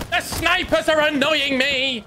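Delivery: high, drawn-out complaint; annoyed whine. Real, not acted. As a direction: wail it slowly, high-pitched. Complains about snipers in game.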